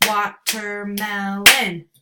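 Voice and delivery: Watermelon slowly split into its four syllables; clapped syllables. Shown as a demonstration. Teacher clapping out syllables for parents, slow and deliberate. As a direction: spell out Watermelon beat by beat. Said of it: "four syllables".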